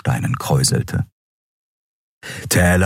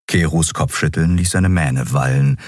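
ordinary delivery

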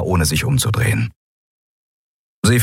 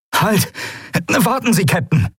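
urgent plea; 12 semitones higher than usual. Acted. First mate begs captain to stop.

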